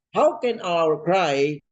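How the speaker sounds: "How can our cry" drawn out slowly, each word weighed; slow stately preacher emphasis. Shown as a demonstration. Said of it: "open and close parenthesis".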